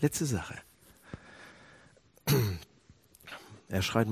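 ordinary talk

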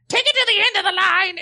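very high screeching rock wail. Pretend. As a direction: screech it like a hair-metal singer.

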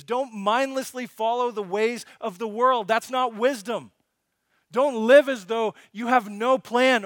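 loud, impassioned sermon, hammering each line; full-force preaching. Acted. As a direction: preach it out to the whole church.

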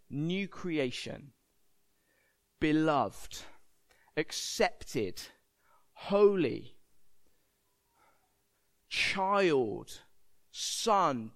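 measured single words, long pauses between; solemn proclamation. Acted. God naming his people over the congregation, solemn. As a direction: let each word land alone, unhurried. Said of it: "God shouts over us these words".

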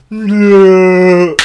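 long drawn-out, loud, high "no"; action-movie yell. Retold or imitated. Retelling his friend popping up like an action movie, mock action-hero yell. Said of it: "one of those, like, action movies".

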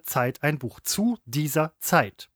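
slow, low "Zeit", set apart after a pause; measured, low and spelled-out teaching tone; pause before "Zeit". Shown as a demonstration. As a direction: demonstrate "Zeit" as its own word. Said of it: "leichte Pause".

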